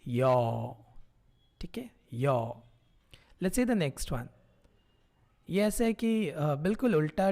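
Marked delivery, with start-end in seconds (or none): 0.1-2.6 यो modelled as yo; Korean vowel yo. Shown as a demonstration. Teacher models यो after the two-line trick. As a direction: say यो as yo, gliding from y into round o. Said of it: "it becomes".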